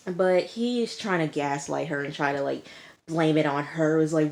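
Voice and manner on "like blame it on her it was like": ordinary talk, nothing marked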